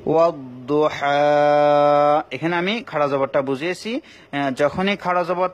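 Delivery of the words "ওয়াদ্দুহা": slow, drawn-out melodic Quran chant; slow chanted Quranic Arabic for a tajweed class; Quran recitation. Shown as a demonstration. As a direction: recite it slowly with tajweed and stop on the last word. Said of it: "এখানে দেখুন আরেকটা নিয়ম".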